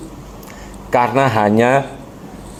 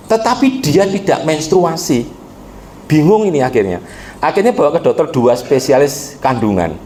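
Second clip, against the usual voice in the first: ordinary talk, nothing marked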